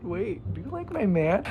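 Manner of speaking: mock suspicion. Acted suspicion toward best friend.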